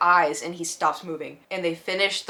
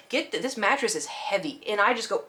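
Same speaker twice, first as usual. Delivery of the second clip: ordinary delivery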